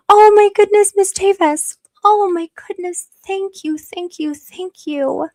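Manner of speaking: high, loud, gushing thanks; overjoyed squeal. Real, not acted. Streamer gushing to a donor, high excited squeal. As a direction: squeal with delight at the donation.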